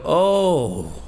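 acted appreciative "oh", drawn out; an approving, falling "oh"; pleased "oh". Husband greeting his wife's new dish, acting pleased. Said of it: "I try to sound like that".

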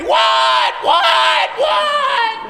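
high, loud, drawn-out yell; screaming back. Retold or imitated. Teenage son at the wheel yells back at dad, screaming. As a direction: yell it back in alarm, stretching each word. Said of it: "he's screaming back at me".

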